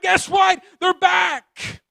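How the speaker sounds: high, loud cry of joy; joyful shout. Acted. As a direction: call it out to the whole street. Parents shout the news.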